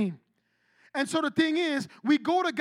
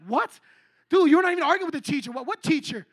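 loud, higher-pitched disbelief; acted-out friend calling out nonsense, fast and loud; mock incredulous; 3 semitones higher, 7 dB louder than usual. Friend challenging an angry student about the teacher, mock incredulous.

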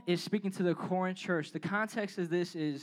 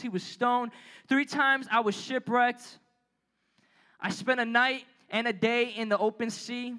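scripture reading; 3 semitones higher than usual. Acted. Pastor reads Paul's list of sufferings to the youth, measured reading. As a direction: read the verses with measured weight.

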